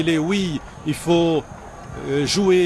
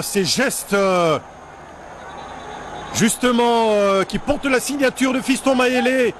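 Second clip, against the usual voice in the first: excited sports commentary; 7 semitones higher than usual. Real, not acted. Goal replay commentary, still elated.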